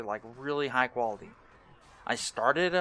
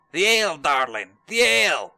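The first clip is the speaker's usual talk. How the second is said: hammed-up louder cry for the ale, rising higher on the second; mock shout for ale; 4 semitones higher, 10 dB louder than usual. Streamer playing thirsty dwarf, mock shouting for ale.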